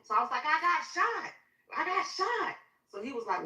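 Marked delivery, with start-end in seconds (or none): 0.5-2.6 high, urgent cry; retold panicked high exclamation; distressed report. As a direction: blurt it out frantically, pitched way up. Telling the officer she was shot.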